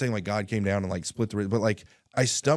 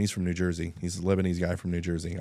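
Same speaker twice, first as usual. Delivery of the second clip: ordinary talk, nothing marked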